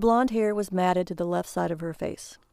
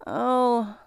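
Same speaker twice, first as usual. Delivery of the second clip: disappointed; 4 semitones higher than usual. A narrator's reading. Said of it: "she said, disappointed".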